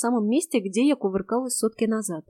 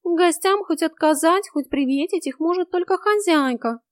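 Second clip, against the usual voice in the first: high-pitched cat voice; high, loud opening to an explanation; urgent cat; 9 semitones higher, 5 dB louder than usual. A narrator's reading. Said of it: "быстро заговорил Васька".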